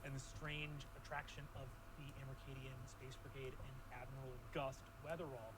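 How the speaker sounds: low, measured storytelling; game master recap. Acted. GM recaps open questions.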